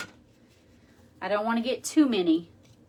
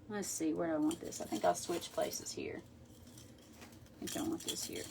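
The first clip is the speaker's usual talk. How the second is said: muttering to herself; 3 semitones lower, 13 dB quieter than usual. Real, not acted. Muttering to herself while arranging.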